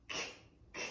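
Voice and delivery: crisp "K" as a clipped k sound; teacher models the k sound for kids. Shown as a demonstration. Teacher modelling "K" as a clipped k sound. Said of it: "Katina Cat sounds like".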